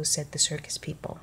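usual speaking voice

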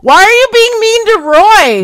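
high, very loud mock protest; protesting shout. Prank caller defends Roy to angry man, protesting shout. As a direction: yell over him, acting wronged.

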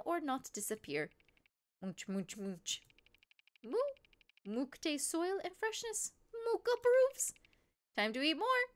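mooing creature hosting an eating show; Mook. Acted. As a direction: play a simple-spoken creature food reviewer. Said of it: "Mook voice. Mook was fun".

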